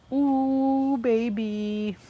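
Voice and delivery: put-on very high, crooned ooh baby; playful high croon; falsetto ooh baby. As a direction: swoon it out in falsetto. Celebrating his steps.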